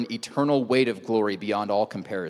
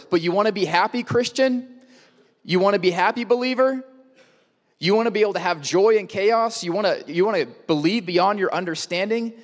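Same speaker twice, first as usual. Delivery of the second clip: higher, eager questions tossed out to the believer; excited, happy Jesus; 7 semitones higher than usual. Retold or imitated. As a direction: coax them with bright, happy energy. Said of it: "Imagine he's excited and happy".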